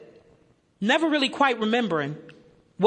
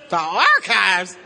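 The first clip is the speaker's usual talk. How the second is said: incredulous; 6 semitones lower, much slower than usual. Retold or imitated. Told to search the archives, he echoes it in laughing disbelief. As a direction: stretch it out in shocked disbelief. Disbelief at being sent downstairs.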